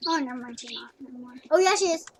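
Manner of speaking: usual speaking voice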